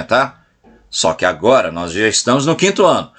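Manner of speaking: ordinary talk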